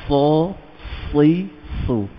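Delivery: heavy "Sa, si, su", one at a time; elevated sad syllables. Shown as a demonstration. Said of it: "Nah, gitu".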